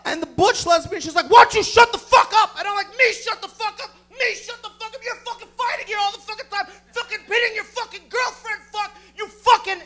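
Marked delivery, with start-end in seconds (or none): 1.3-2.6 high, loud acted fury; enraged shout. Enraged woman shouting in retold fight. 3.0-9.8 high-pitched acted yelling tirade; furious screaming. Screams back in retold fight.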